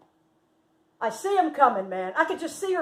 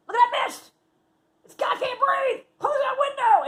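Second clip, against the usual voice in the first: very high, loud fretting; alarmed ordering; 7 dB louder than usual. Retold or imitated. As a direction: imitate a friend in a panicky fuss. Friend frets over chemtrails, can't breathe.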